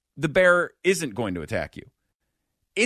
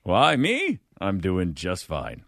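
bear replies; 9 semitones lower, slower than usual. Retold or imitated. Voicing the bear's reply.

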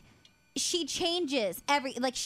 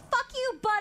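much higher, snapped-out mock curse; mock angry outburst; 13 semitones higher than usual. Jokingly cursing at younger sister Butter, high mock outburst. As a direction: fling it at her like a playful insult.

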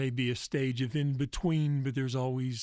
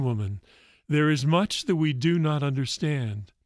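a radio host reading a faith story aloud, growing louder as it builds; story narration; 2 semitones higher, 7 dB louder than usual. A narrator's reading. Priest on air reads his weekly faith story to listeners, dramatic narration. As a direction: tell the parable to your listeners, building intensity.

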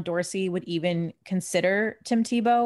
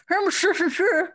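imitating a thick London accent, higher; squashed, hard-to-follow London pronunciation of Hammersmith and City; mumbled London accent; 12 semitones higher, 4 dB louder than usual. Mimicking the Underground stranger's thick London accent. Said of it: "it sounded like he said".

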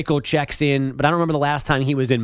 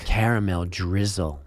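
low smooth seductive ad voice; sultry narrator; 8 semitones lower, 3 dB quieter than usual. Pretend. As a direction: purr it like a sexy ad read. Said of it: "Do you have to do it in a sexy voice?".

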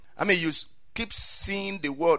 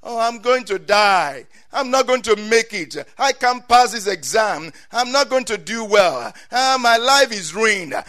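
loud, raised-pitch hammed-up complaint; frustrated outburst; 5 semitones higher, 9 dB louder than usual. Someone agitated before an exam, frustrated outburst. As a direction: wail it out like everything is hopeless. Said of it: "We get so mad, something frustrates us".

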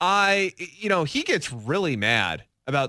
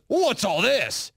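gruff; 6 semitones higher, slower than usual. Retold or imitated. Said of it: "He's just gruff".

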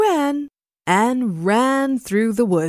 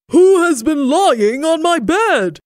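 loud, raised Papa Bear outcry; great big voice; 8 semitones higher, 5 dB louder than usual. A narrator's reading. Papa Bear finds his bed used, great big voice. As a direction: boom it out, indignant. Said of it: "in his great big voice".

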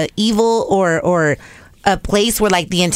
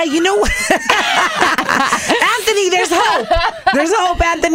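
high, laughing, teasing call; 11 semitones higher than usual. Real, not acted. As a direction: tease him with a squealing laugh. Teasing shout to Anthony.

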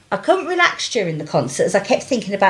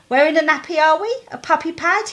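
teasing; 7 semitones higher than usual. Retold or imitated. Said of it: "he said".

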